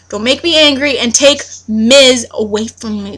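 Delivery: ordinary talk, nothing marked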